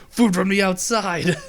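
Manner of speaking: high, loud accusing cry; mock guard's shout. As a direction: call out like an outraged guard. Security guard spots smuggled snacks.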